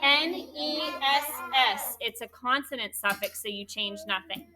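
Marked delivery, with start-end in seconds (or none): 0.0-2.0 spelled letter by letter. Shown as a demonstration. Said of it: "What's the suffix?".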